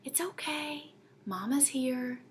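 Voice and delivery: put-on soft whispered comfort to a sick child; soothing mother. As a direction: hush her gently, high and soft. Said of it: "first thing I said to my little girl".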